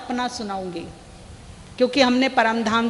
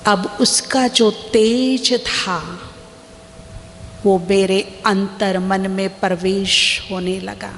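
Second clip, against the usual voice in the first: slow, lower meditation voice with long pauses; guided meditation; 4 semitones lower, slower than usual. Acted. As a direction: unhurriedly guide listeners through a vision, lingering on key words. Leads a guided visualization.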